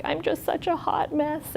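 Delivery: high, soft mock distress; lightly acted, high-pitched self-reproach; imitated upset patient. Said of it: "she was just really hysterical".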